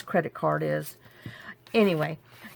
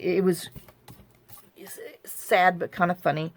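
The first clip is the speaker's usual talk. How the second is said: ordinary talk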